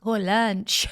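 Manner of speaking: imitated comic French accent. Viewer quoting the comedian's French bit, imitated French accent. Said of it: "His French accent was killing me".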